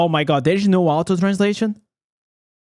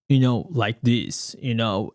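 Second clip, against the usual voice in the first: lower, slow, a hint of a grown-up man's voice; put-on serious creator voice; 8 semitones lower than usual. Shown as a demonstration. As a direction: mimic the serious content creator, lightly deepened. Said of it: "try to make a different voice".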